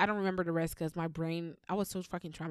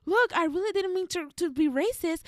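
higher, louder whiny plea; mock crying boy; 10 semitones higher, 6 dB louder than usual. Boy denying racism while crying, whiny mock plea. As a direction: imitate his teary excuse. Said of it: "he was crying, and he said".

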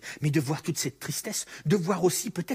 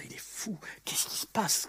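whispered aside, much softer; 5 semitones higher, 5 dB quieter than usual. Retold or imitated. Onlookers whispering among themselves that Jesus is mad. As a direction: murmur it like gossip in the crowd. Crowd's doubt voiced aside.

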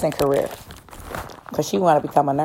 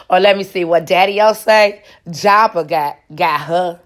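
ordinary delivery

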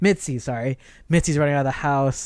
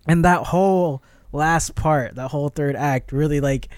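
ordinary talk

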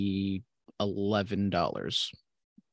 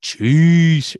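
mock announcer; 5 semitones higher, 9 dB louder, much slower than usual. Jokey mock line, very slow.

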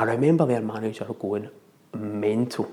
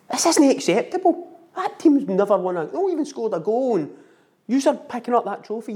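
lauter Trainer ruft hoch und kräftig ins Spielfeld; coach shouting; 8 semitones higher, 3 dB louder than usual. Retold or imitated. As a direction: den Kindern lautstark die Leviten lesen. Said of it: "So he's shouting them".

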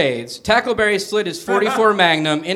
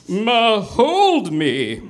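very slow, dreamy drawl; dreamy old man voice; 3 semitones higher, much slower than usual. Acted. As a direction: stretch it out, lovesick. Lassard spooning after sex.

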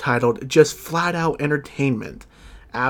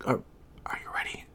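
hushed, whispered question; suspenseful whisper; 13 dB quieter, faster than usual. Real, not acted. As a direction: whisper it to tease the big reveal. Host builds suspense before winner.

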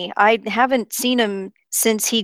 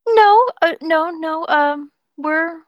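flustered; 5 semitones higher, slower than usual. Real, not acted. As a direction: caught off guard, babbling. Flustered refusal to hostess.

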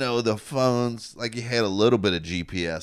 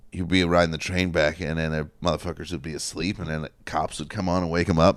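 normal talking voice

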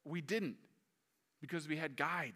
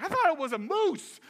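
high, louder panicky admission; sheepish fright; 12 semitones higher, 8 dB louder than usual. Retold or imitated. Telling the guides he thought it was a moose, panicky. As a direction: blurt it out, still shaken. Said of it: "I was like".

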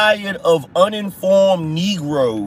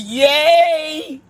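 high, slow, mock-gleeful cheer; high mock cheer for the CNN crowd; 9 semitones higher, much slower than usual. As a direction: parody a gullible news watcher cheering. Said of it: "Talking about".

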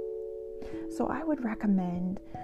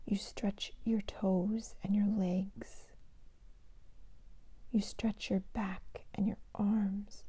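sleep meditation whisper; 5 dB quieter than usual. Shown as a demonstration. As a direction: whisper them gently toward sleep. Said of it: "I'd like to share a meditation with you today".

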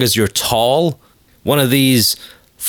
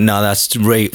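usual speaking voice

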